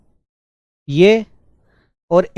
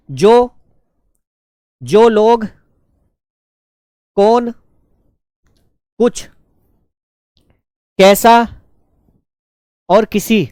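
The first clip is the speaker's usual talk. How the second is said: higher, louder voice, isolated words with pauses; word-by-word dictation; 4 semitones higher, 5 dB louder, slower than usual. Shown as a demonstration. Teacher tells students to keep writing onward, word-by-word dictation. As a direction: call out each word and wait. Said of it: "लिखो, जो, लिखते चलो आगे".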